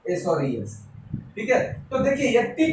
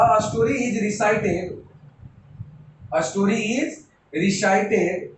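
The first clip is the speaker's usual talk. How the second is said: slow dictation; much slower than usual. Shown as a demonstration. Teacher writing the example.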